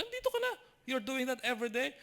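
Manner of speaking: high surprised exclamation; surprised coworkers. Retold or imitated. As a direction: mimic the startled office mates. Surprised office mates quoted.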